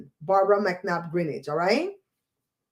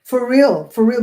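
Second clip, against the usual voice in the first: emphatic for real; 3 semitones higher, 8 dB louder than usual. Real, not acted. Insisting, laughing.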